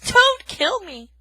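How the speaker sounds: high, loud panicked plea; a frightened mouse begging for her life; terrified cry. Acted. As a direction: scream it in terror. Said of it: "Poppy cried through chattering teeth".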